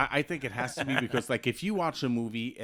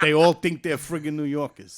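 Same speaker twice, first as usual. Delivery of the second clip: put-on New York accent; 3 semitones higher, 9 dB louder than usual. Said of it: "New York accent".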